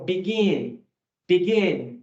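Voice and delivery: "begin" with the weight on "gin"; stress on second syllable. Shown as a demonstration. English teacher modelling the word for students, final-syllable stress on "begin". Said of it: "a entonação tá no gin".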